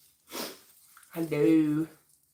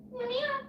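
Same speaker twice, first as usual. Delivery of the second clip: high cat meow; 6 dB quieter than usual. Pretend. Meowing at cat.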